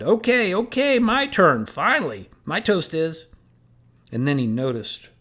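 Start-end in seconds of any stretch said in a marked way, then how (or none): 0.0-3.3 loud, high eager shout. A narrator's reading. Coach shouting to the room for his toast. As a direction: shout it out, finally his turn. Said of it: "He shouted".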